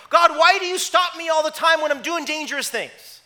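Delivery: loud, high-pitched mock whining protest; complaint to God. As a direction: wail it upward like an aggrieved kid. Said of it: "we probably say to God".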